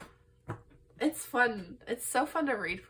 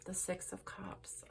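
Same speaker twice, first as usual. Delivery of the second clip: hushed card name; 11 dB quieter, slower than usual. Real, not acted. Reading out a tarot card.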